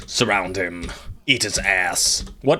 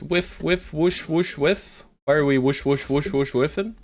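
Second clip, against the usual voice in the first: mock whoosh noises; 6 semitones higher than usual. Mocking missed attacks.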